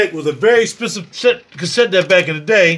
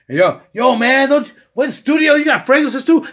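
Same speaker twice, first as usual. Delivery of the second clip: lightly voiced surprised friend, higher; mock friend; 6 semitones higher than usual. A studio friend asks about his fragrances, mock surprise. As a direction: act out a buddy amazed he has fragrances too.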